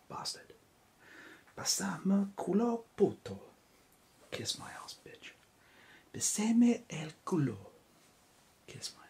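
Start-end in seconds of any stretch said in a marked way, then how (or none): 1.6-3.4 put-on Spanish accent, raised up out of the whisper; hammed-up Spanish accent on the insult; voiced Spanish accent, higher and louder; weird Spanish accent. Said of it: "with a weird accent". 6.1-7.6 put-on Spanish accent, raised up out of the whisper; hammed-up Spanish accent on the insult; voiced Spanish accent, higher and louder; weird Spanish accent. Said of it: "with a weird accent".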